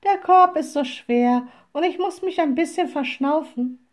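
high, plaintive little voice; old woman act. A narrator's reading. Disguised witch excuses her rest to forester, plaintively.

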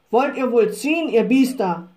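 loud, rough scolding; coachman's shouting. A narrator's reading. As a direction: yell at the stubborn horses to pull. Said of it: "schrie er". (lautes Schimpfen eines Bierkutschers)

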